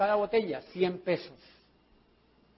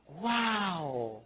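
drawn-out, softer mock wow; amazed group wow; 2 semitones lower, 7 dB quieter than usual. Shown as a demonstration. Speaker showing the group how to react, mock-amazed. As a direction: show them a long, wowed reaction.

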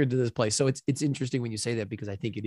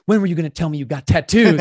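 loud, higher imitation of an angry sister; retold angry outburst; 5 semitones higher, 10 dB louder than usual. Sister confronting the actor after the scene, retold angry outburst. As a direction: act out her indignant outburst loudly. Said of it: "she was so angry at me".

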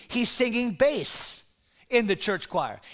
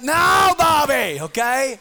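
higher, louder no; mock exasperated shout; 4 semitones higher, 7 dB louder, slower than usual. Pastor cutting off the boy at the table, loud mock refusal. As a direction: snap it back at the kid.

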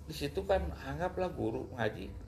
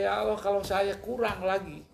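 re-enacted desperate appeal; pleading prayer; 6 semitones higher than usual. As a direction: implore God, voice lifted. Acting out his prayer to God.

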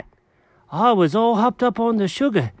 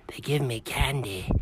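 dreamy hush; 5 semitones lower, 11 dB quieter than usual. Acted. [name] recalls his night.

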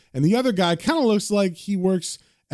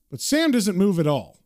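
usual speaking voice

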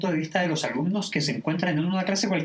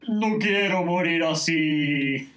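slow, drawn-out mock wail; hammed-up lament; 3 semitones higher, much slower than usual. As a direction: moan it out, stretching every vowel. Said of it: "Pierce continúa lamentándose".

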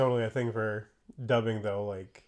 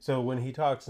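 normal talking voice

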